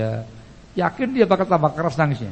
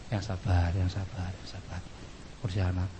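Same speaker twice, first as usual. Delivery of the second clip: low, very soft reassurance; calm her down softly, fading away; acted tender hush; soothing husband; 7 semitones lower, 11 dB quieter than usual. Husband soothing his upset wife.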